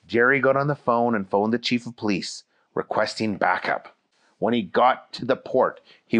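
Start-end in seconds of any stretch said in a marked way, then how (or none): none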